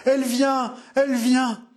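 feverish mother murmuring. A narrator's reading. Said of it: "murmurait la mère".

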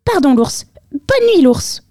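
high, quick squeaky mouse; apologetic mouse. A narrator's reading. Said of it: "souffla la souris".

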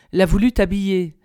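ordinary delivery

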